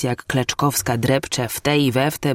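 plain reading voice

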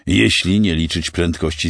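plain reading voice

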